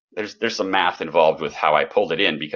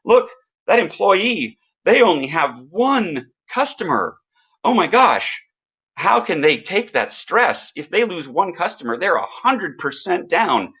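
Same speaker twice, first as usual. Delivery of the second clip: mock incredulous entrepreneur; 9 semitones higher than usual. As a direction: play up the disbelief at one customer. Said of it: "The entrepreneur stands over here and goes".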